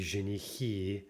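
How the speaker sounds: женихи with the stress on the final syllable, жениХИ; end stress. Shown as a demonstration. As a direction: say женихи, landing hard on the last syllable ХИ. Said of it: "you can hear that that happens to be an in-stressed".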